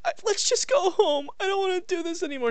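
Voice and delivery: high girlish mock whine; mock girlfriend voice. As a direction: squeak it out like a fed-up girlfriend. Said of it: "she's like".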